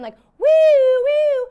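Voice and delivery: high, drawn-out siren wail; siren noise. Retold or imitated. Mimicking police siren at the scene, high wail.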